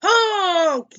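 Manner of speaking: long, high, loud truck honk; yelled honk. A narrator's reading. Dump truck barging through, yelled honk. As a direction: blare it out like a horn. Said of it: "yelled a dump truck".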